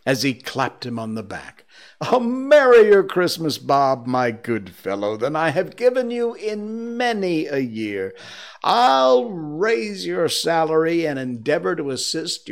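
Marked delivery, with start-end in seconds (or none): 2.1-12.5 high, exuberant, swelling well-wishes; hearty earnestness. Acted. Scrooge promises his clerk a raise, earnest and elated. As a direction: pour it out loudly and joyfully. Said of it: "with an earnestness that could not be mistaken".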